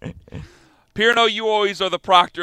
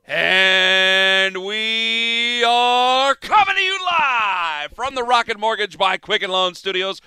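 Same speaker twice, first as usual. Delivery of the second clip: long drawn-out, rising radio announcer call; show-opening announcer; 3 semitones higher, much slower than usual. Acted. Host opening the radio show to listeners, booming announcer style. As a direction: stretch it out like a big live show intro.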